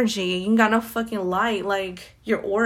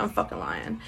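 much lower and softer, a joking growl; deep mock voice; 9 dB quieter than usual. Repeating her confession to listeners in a deep joke voice. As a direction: drop way down low and murmur it.

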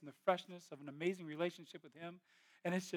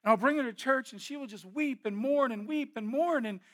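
loud and high, building fervour; loud, high sermon delivery, rising passion; preaching at full force; 7 semitones higher, 10 dB louder than usual. Acted. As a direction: preach it out to the whole room.

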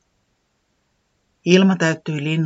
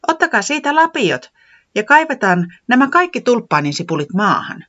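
high, louder cheerful directions; instructing; 9 semitones higher, 5 dB louder than usual. Acted. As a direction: hand out the shovels. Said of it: "se ohjeisti".

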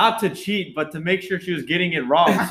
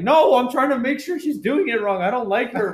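high, protesting voice; retold indignant defence; 8 semitones higher than usual. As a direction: protest as if wrongly accused.

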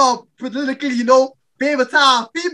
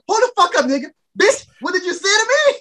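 angry high yell; 7 semitones higher than usual. Retold or imitated. Girl furious at insult.

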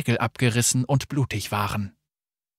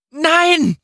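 high, loud anguished scream; 7 dB louder than usual. A narrator's reading. Akali watching the debris bury someone, screaming loudly. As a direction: scream no as the rubble buries them. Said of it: "schrie Akali".

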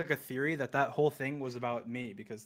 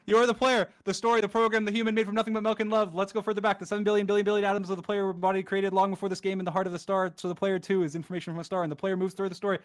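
fast, higher, breathless poem reading; rushed reading; 6 semitones higher, 5 dB louder, faster than usual. Real, not acted. Gamer racing the End Poem credits, hurried frantic reading. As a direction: cram the words before they vanish. Rushing to keep up with scroll.

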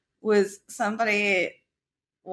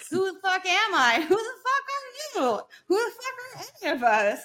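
high-pitched retold outburst, breaking into a laugh; escalating high, incredulous retort; mock-indignant comeback; 8 semitones higher, faster than usual. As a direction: throw it back at them, pitched well up. Retold comeback to rude strangers.

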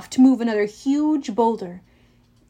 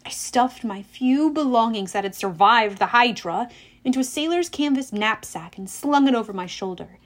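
usual speaking voice

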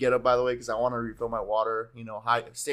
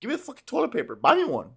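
higher, louder, keen offer to pay; eager plea; 6 semitones higher, 4 dB louder than usual. Retold or imitated. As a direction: push the friend hard to share the deal. His excited offer to friend.

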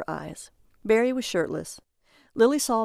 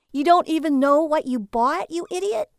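high, loud, incredulous insult; angry; 8 semitones higher, 6 dB louder than usual. A narrator's reading. Wife berating her clueless husband, angry. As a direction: shout it at him, pitch climbing. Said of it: "Lily angrily sashed into the bathroom".

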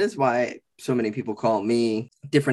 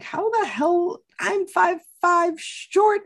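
much higher, slow squeaky disbelief; mock disbelief; slower than usual. Retelling coworkers' fear, mock high squeaky disbelief. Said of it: "And I'm just like".

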